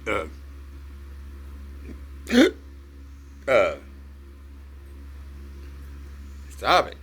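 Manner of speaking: hiccuping between words; hiccups. Real, not acted. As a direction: hiccups interrupting speech. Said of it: "I got the hiccups".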